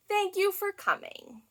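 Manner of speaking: usual speaking voice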